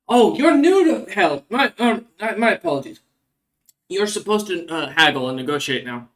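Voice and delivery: a lightly voiced merchant, eyebrow arched. Merchant correcting a newcomer about haggling, lightly mocking. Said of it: "with an eyebrow raised and goes".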